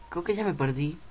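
normal talking voice

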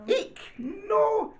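high-pitched storybook squeal; mock fright. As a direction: squeak in pretend alarm. Acted fright at the shark.

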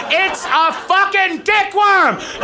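exasperated comic yelling at the crowd; shouted rant. Real, not acted. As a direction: bellow it in disbelief. Ranting at audience.

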